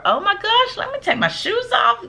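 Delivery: high-pitched gushing relief; delighted guest. Acted. Acting out a welcome vibe.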